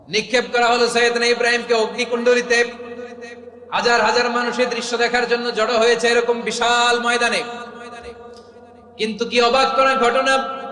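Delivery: loud, high-pitched sermon delivery; full-force preaching. Real, not acted. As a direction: proclaim it to the whole crowd.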